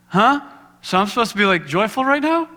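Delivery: high, baffled questioning; incredulous. Retold or imitated. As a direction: sound thrown and disbelieving. Retold confused reply to God.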